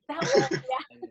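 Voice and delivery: ordinary talk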